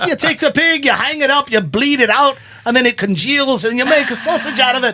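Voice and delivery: high, loud Irish lilt, explaining matter-of-factly; mock Irish publican explaining blood sausage; Irish accent. Voicing Irishman Willie explaining.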